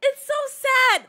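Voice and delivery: high, slow, broken crying voice; the words break as the tears come. Real, not acted. Streamer reading civil rights history, high and slow through tears. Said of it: "I can't even get through this".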